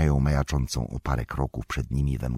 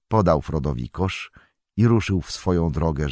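usual speaking voice

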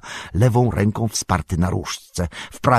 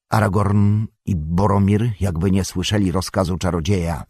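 usual speaking voice

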